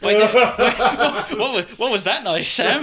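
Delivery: high, loud laughing question. Real, not acted. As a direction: laugh through the words, slowly at first. Laughing at friend's squeal.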